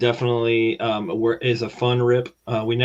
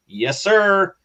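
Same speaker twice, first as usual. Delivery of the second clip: excited; 9 semitones higher, 5 dB louder than usual. Real, not acted. Hyped about his card plan.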